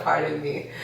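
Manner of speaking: ordinary delivery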